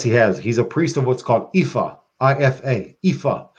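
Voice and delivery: normal talking voice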